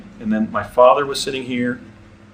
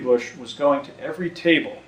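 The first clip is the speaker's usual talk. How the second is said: usual speaking voice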